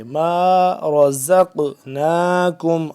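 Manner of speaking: bounced ق in slow, loud chanted رزقناکم; qalqala. Shown as a demonstration. Teacher demonstrates qalqala, chanting with a bounced qaf. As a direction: let the ق of رزقناکم echo briefly. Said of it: "اس پہ قلقلہ بھی کریں گے".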